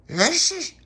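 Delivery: "This is" blurted high and loud like a sneeze; sneeze-like German. Retold or imitated. Pop imitating the cobbler's German, sneezy high burst. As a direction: sneeze the words out. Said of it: "it sounded like a sneeze".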